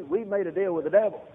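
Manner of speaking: ordinary delivery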